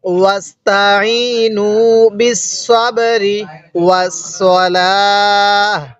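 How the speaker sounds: ওয়াস্তাইনু বিসসাবরি chanted slowly in a recitation voice. Shown as a demonstration. Teacher modelling the verse for students, slow chanted recitation. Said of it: "তাহলে কিভাবে পড়ব?".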